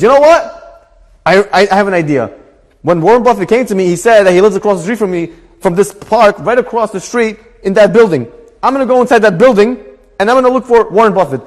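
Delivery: high, loud acted determination; excited resolve. Acted man hits on an idea.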